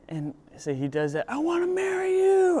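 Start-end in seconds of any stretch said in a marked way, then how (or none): 1.3-2.6 high, strained yell out the window; retold yell. Brendan screaming out the bus window, high mock yell. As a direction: shout it to a stranger outside.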